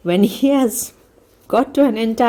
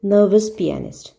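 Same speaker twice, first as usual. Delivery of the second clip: ordinary delivery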